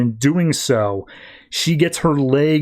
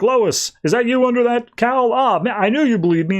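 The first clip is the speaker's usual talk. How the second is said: much higher, eager mock voice; villain grabbing at hope, pitched up; 10 semitones higher than usual. Villain greets Lois under the cowl, in an eager higher voice. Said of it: "immediately Mangubat's like".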